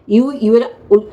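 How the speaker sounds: a halting imitation of ইউ; mock stumbling pronunciation. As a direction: slowly stammer ইউ, ইউ এর উ- like a bad reader. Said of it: "নিজস্ব উচ্চারণ".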